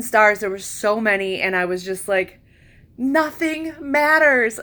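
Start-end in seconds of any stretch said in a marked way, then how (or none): 3.0-4.6 euphoric. Retold or imitated. Euphoric under the stars.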